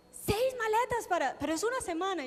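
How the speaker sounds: put-on a young host gawking at the luggage; mock astonished. Retreat hosts counting her suitcases, mock astonished.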